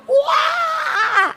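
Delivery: long high-pitched mock wail; retold scream. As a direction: re-enact your scream on one high held note. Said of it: "I'm screaming like".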